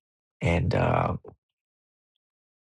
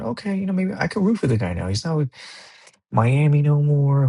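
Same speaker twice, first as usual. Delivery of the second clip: high, lilting thought voice, slowing at the end; musing aloud, pitched up and hopeful; hopeful inner voice; 7 semitones higher than usual. Retold or imitated. Fan deciding he might root for LeBron, pitched high. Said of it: "and then I thought".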